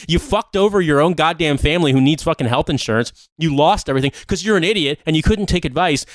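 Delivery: ordinary delivery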